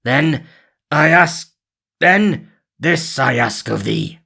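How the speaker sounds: a hint of harsh Guy; Robin as Guy. A narrator's reading. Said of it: "said Robin".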